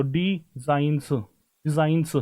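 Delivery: डिजाइंस drawn out very slowly, said twice; slow sounding out. Shown as a demonstration. Teacher slowly sounding out डिजाइंस for the class. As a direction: spell out डिजाइंस with long held sounds. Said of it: "डिजाइंस मुझे लिखना है".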